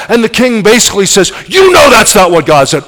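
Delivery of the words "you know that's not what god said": sharp, loud angry retort; angry rebuke. Acted. King snapping at the prophet, angry. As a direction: bark it back at him in anger.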